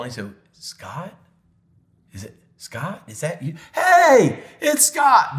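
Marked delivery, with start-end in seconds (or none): 0.5-3.5 hushed, halting questions; imitating a confused father's soft, uncertain query; tentative recognition. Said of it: "squinting his eyes like he was trying". 3.7-5.4 sudden high, loud outburst; excited shout, acting out dad; joyful recognition. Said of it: "Like this".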